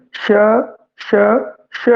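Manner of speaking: ਸ਼ as a long voiceless hush, repeated; hissed sound demo. Shown as a demonstration. Teacher demonstrating ਸ਼ to students. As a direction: push out each ਸ਼ as a breath. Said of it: "ਉਚਾਰਨ ਕਰਕੇ ਦੇਖੀਏ".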